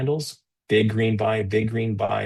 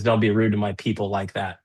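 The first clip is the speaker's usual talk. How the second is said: usual speaking voice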